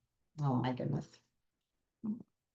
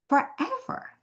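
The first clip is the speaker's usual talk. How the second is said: "forever" swooping far higher and softer; soaring forever; 3 dB quieter than usual. Real, not acted. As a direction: float "forever" way up high.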